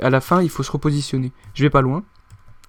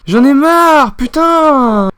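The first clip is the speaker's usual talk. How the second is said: frustrated outburst; 8 semitones higher, 5 dB louder, much slower than usual. Real, not acted. Rage de joueur après un échec.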